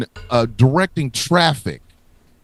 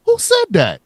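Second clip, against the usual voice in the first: high indignant demand; 12 semitones higher, 3 dB louder than usual. Pretend. New York demanding who spoke.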